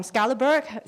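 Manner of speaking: ordinary delivery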